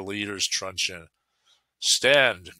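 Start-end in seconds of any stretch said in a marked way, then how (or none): 1.8-2.5 loud, slightly higher command; commanding cry. A narrator's reading. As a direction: cry out the order like a leader. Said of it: "cried he".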